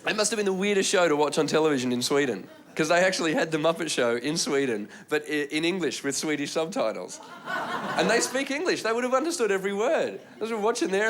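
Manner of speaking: ordinary delivery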